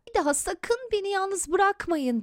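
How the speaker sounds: put-on high-pitched child's plea; pleading, voiced as a young boy; earnest plea. A narrator's reading. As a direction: beg parents not to leave. Boy pleads while hugging father.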